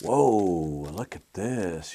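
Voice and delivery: a drawn-out, ogling whoa; impressed whoa. Retold or imitated. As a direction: say it like a man catching sight of something tempting. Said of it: "and he went".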